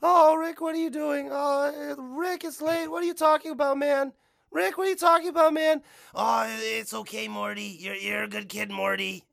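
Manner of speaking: high cartoon voices swapping between Morty and Rick. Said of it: "Remember when he busted into Morty's room".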